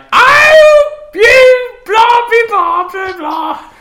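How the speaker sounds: very high, shouted squeaky dragon; goofy high dragon yelling nonsense; cartoon dragon voice. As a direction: shriek it out like a silly little dragon. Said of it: "the dragon would be like".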